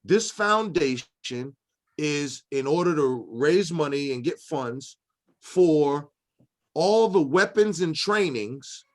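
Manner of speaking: slow, deliberate quoted text; slow formal reading. Retold or imitated. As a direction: read it out slowly, word by word. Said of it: "it literally will say".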